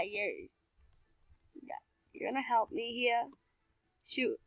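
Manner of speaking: ordinary delivery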